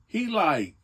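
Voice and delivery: normal talking voice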